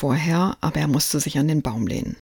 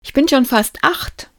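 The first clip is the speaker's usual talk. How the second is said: put-on much higher, eager child voice; proud boy; 9 semitones higher, 4 dB louder than usual. A narrator's reading. Lukas boasts to Santa, high proud boy. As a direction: boast like a small kid.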